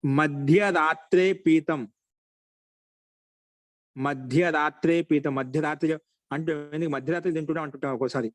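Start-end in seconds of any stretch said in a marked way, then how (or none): 0.0-1.8 slow, deliberate chanting of మధ్యరాత్రే పీతం; slow classroom recitation. Shown as a demonstration. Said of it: "teacher recites Sanskrit example". 4.0-5.3 slow recitation. Shown as a demonstration. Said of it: "teacher repeats the example".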